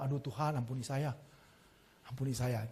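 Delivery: half-joking whispered plea for forgiveness; soft mock repentance. Pastor repents afterwards. (pendeta menyesal, pelan dan lirih)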